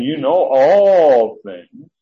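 slow, loud, each word weighed; emphatic. Shown as a demonstration. Peter to Jesus, slow and emphatic. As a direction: lean hard on all things. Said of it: "You emphatically".

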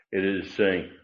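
normal talking voice

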